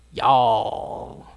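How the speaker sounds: low, drawn-out Texan y'all; Texan twang, long and lazy; southern drawl. Acted. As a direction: put on a Texas drawl, stretching the word. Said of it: "They got that southern drawl".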